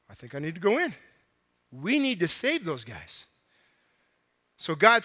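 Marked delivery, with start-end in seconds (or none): 0.1-3.3 decide gently that you must go; quiet resolve. Acted. God telling the Trinity he will go save humans, quiet resolve.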